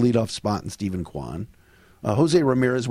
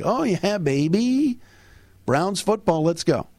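excited; 6 semitones higher, slower than usual. Real, not acted. Hyped about Browns camp.

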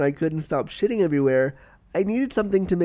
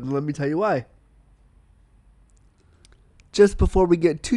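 ordinary talk

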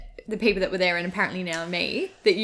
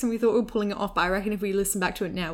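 ordinary talk

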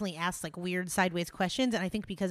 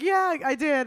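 high, louder sheepish mimic; 7 semitones higher, 7 dB louder, slower than usual. She imitates herself admitting it, high and sheepish. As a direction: squeak it from the corner. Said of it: "I was in the corner like".

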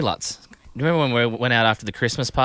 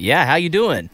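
put-on American accent; 5 semitones higher, 4 dB louder, slower than usual. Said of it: "just in an American accent".

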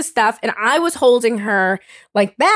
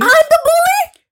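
much higher and louder disbelieving cry; incredulous squeal; 5 dB louder than usual. Real, not acted. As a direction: shriek it in mock disbelief. Indignant disbelief, crying-pitched squeal.

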